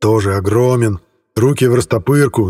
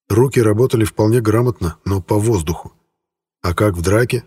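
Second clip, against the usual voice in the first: plain reading voice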